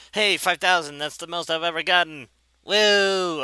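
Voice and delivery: loud, high cheer ending in a whoop; high, loud triumphant outburst; excited celebration. Real, not acted. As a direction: exclaim a new personal best, elated. Celebrates best score ever.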